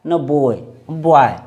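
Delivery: बॉय with the vowel between o and aa, slowly; correct pronunciation. Shown as a demonstration. Teacher slowly modelling the right vowel. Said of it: "न बाय".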